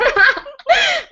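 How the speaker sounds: very high, helpless laugh; real high-pitched cackling fit; shrieking laugh. Real, not acted. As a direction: squeal with laughter, out of control. Cracks up at an embarrassing line.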